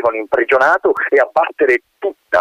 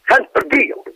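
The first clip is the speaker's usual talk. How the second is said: grumbling colonel; slower than usual. Acted. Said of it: "brontolò tra sé".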